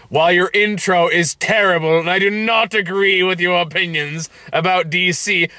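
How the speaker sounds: mock commenter. Host quotes a critical video comment, mock indignation. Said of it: "And he goes".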